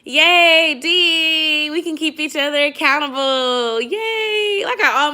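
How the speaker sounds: loud high happy cheering; excited cheer. Real, not acted. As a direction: cheer for a friend. Cheering a fellow dieter.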